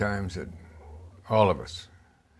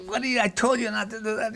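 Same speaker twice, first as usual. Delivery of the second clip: retold yell, pitched much higher; mock angry yell; 12 semitones higher than usual. Director Richard scolding his camera operator, retold high angry yell. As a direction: imitate the director blowing up at you. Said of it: "I'm screaming".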